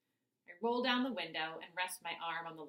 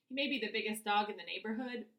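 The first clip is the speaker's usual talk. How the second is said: usual speaking voice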